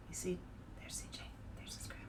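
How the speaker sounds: fast hushed aside; whisper. Real, not acted. Reader whispering to kids about the illustration. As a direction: point at the picture and whisper.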